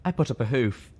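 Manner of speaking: plain reading voice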